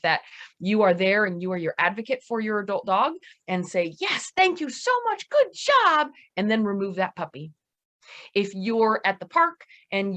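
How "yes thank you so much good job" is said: high-pitched happy thanks; gushing dog praise. Shown as a demonstration. Owner rewards adult dog as its advocate, high gushing praise.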